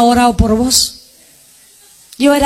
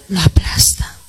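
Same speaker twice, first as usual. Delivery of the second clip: whisper; 7 semitones lower than usual. Acted. Contrast humble/proud, whispered.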